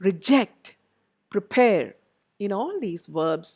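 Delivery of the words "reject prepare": "Reject, prepare" with louder second syllables; re-JECT, pre-PARE, light starts to "Reject, prepare"; second-syllable stress. Shown as a demonstration. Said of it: "second syllable that the stress falls".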